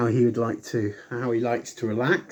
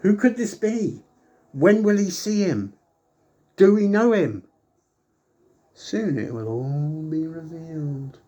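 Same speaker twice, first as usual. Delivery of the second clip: higher dramatic teaser voice; mock mystery announcer; 3 semitones higher than usual. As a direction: tease it like a TV promo.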